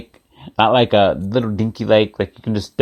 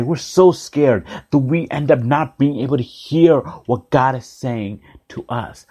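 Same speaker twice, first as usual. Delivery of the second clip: ordinary talk, nothing marked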